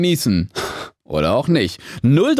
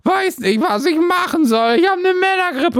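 whiny sick voice; 13 semitones higher than usual. Pretend. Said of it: "denkt euch".

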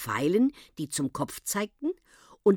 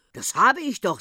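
mildly astonished, higher and louder; 5 semitones higher, 5 dB louder than usual. A narrator's reading. Uncle on the phone to old woman, mildly astonished. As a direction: protest with a touch of surprise. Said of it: "sagte der Onkel erstaunt".

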